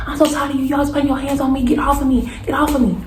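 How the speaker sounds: high-pitched, repetitive mock yell; retold yelling. Woman yelling over and over at her attacker, retold. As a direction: plead and protest as if shouting, volume held back. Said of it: "I'm yelling over and over again".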